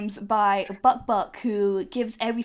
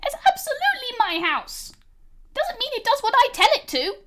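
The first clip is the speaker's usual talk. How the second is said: put-on much higher, small girl's voice; little May; 12 semitones higher than usual. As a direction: speak as a tiny proud girl. GM voicing May.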